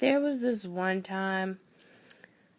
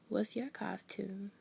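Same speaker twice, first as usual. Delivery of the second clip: put-on slow breathy whisper of a curious girl; hushed question; 9 dB quieter, slower than usual. A girl at school whispering her question about the costume. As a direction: whisper it slowly, sizing her up. Girl asks at Halloween parade.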